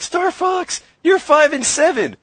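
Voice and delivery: high, loud, slow mock scolding; angry yelling. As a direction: yell at the teammate, hammed up. Angry teammate imitated.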